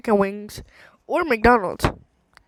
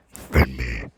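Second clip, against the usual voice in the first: 1 mock deep voice; much slower than usual.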